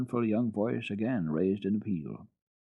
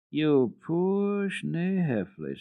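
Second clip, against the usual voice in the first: high, very slow and gentle; tender; 9 semitones higher, much slower than usual. A narrator's reading. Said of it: "tenderly toward her".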